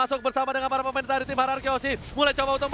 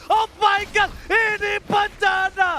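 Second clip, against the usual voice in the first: shouting hyped play-by-play; esports caster; 9 semitones higher, 7 dB louder than usual. Real, not acted. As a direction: scream the teamfight call. Teamfight climax call.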